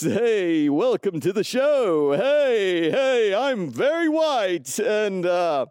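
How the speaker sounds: high-pitched, stretched-out parody show host. Pretend.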